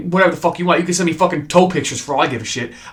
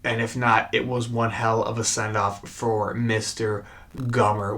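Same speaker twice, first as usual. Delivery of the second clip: choked up; 5 semitones lower, 8 dB quieter than usual. Real, not acted. Fan saying goodbye to a beloved character, choked up. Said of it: "I'm getting emotional".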